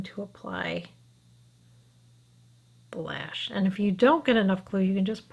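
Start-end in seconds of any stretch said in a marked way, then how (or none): 0.0-3.5 soft unvoiced whisper while concentrating. Real, not acted. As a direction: whisper it while working carefully. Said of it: "I don't know why I'm whispering".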